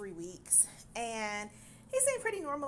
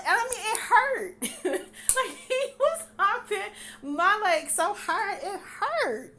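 laughing; 8 semitones higher, 8 dB louder than usual. Real, not acted. Laughing while recalling pain.

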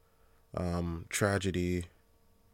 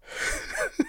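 breathy giggle; 6 dB quieter than usual. Real, not acted.

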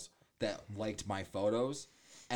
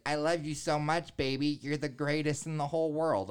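higher, louder sappy mimic; mock gushing boyfriend; 6 semitones higher, 5 dB louder than usual. Mocking a friend's sappy posts, higher hammed-up gushing. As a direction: ham up the lovesick post.

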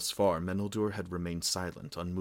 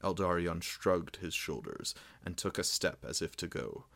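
usual speaking voice

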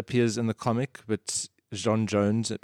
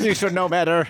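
mock offended nerd; 8 semitones higher, 3 dB louder than usual.

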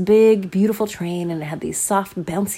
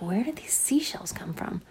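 softer puzzled whisper; wondering whisper; 5 dB quieter than usual. Acted. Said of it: "They're like".

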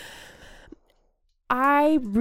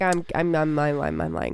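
mock sheepish confession; 7 semitones lower than usual. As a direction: mumble it, dropping away to the bottom of your range. Acting out owning up to lying.

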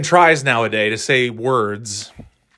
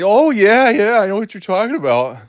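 much higher, louder gushing agreement; mock eager coworker; 8 semitones higher, 4 dB louder than usual. Imitating a coworker faking agreement in the break room, high and gushing. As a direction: play a coworker nodding along too eagerly. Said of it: "And they".

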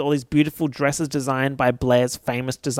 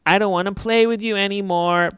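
Toy Story quote; 6 semitones higher than usual. Retold or imitated. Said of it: "Just like Toy Story".

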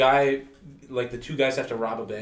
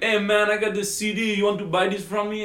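higher, louder Jamaican hustler voice; Jamaican accent; 9 semitones higher, 5 dB louder than usual. Retold or imitated. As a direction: imitate a Jamaican street seller. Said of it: "he had a Jamaican accent".

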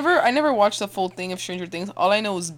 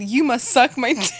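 mock Papa order; 5 semitones higher, 6 dB louder than usual. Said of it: "Papa said".

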